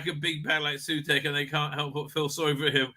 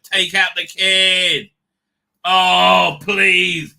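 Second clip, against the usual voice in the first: mock cheering; 2 semitones higher, 10 dB louder, much slower than usual. Retelling his hoping reaction.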